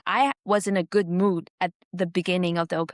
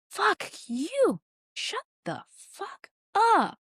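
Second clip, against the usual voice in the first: much higher, staged furious yell, slowing at the end; acted rage; 13 semitones higher, much slower than usual. Comedian retelling how she yelled back at the heckler. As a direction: blast it like a stage actor faking rage. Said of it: "I just yelled with my actor voice".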